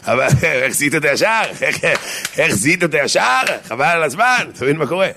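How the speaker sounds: hammed-up astonished husband; mock amazement. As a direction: marvel aloud with a laugh in it. Husband teasing wife in joke.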